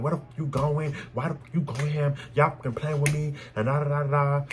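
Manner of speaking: reliving the fight as she pushes her man; retold snapping. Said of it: "I'm getting mad and I'm snapping".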